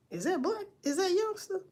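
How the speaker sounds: high, confused question; asking in disbelief, voice jumping up; surprised. Retold or imitated. Bystander at the scene asking in surprised disbelief. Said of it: "You know, surprise".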